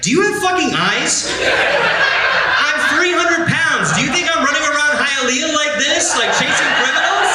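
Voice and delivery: high, exasperated mock retort, laughing through it; incredulous. Comic telling the crowd his retort to the doctor, high and exasperated. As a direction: shout the disbelief up high with a laugh.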